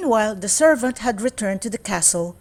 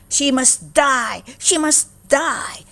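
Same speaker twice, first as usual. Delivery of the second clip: slow, higher, understated furious cry; mild screamed rage; 6 semitones higher, 4 dB louder, slower than usual. A narrator's reading. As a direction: stepmother spits out her rage. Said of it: "she screamed".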